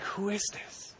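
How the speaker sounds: breathless whisper; awed whisper. Retold or imitated. Disciples marveling in a hushed whisper. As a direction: whisper it in awe.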